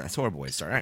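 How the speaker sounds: muttered low repeat. Shown as a demonstration. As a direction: drop it low and quiet.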